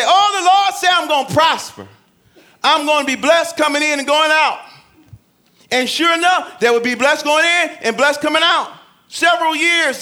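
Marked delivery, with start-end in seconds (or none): 0.0-4.6 excited believer quote. Retold or imitated. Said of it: "And they say".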